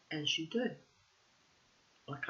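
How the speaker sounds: plain reading voice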